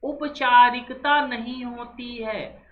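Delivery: slow, measured reading of आत्मीयता and औपचारिकता, each word laid out; slow, careful classroom reading; slow dictation read. Shown as a demonstration. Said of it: "fourteenth क्या कह रहा है".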